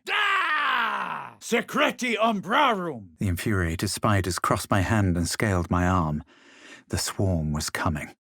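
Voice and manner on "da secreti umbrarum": very high shouted incantation; shouted spell. Acted. Adventurer casting a spell, shouted high. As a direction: hurl the spell at the foe.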